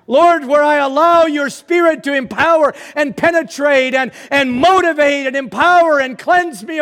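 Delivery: high, loud, pleading revival cry; impassioned preaching prayer. Real, not acted. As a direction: cry out to God at full force. Impassioned prayer turning into exhortation.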